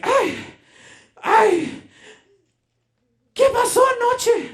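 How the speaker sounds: high, loud Spanish outburst; furious mom bursting in, shouting in Spanish; angry mom. Retold or imitated. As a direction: shriek it like a furious Mexican mother. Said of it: "angry as could possibly be".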